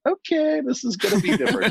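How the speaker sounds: high-pitched, half-laughing mock unease; uneasy reaction. Ranger recalling her reaction to bear attack stories, mock uneasy.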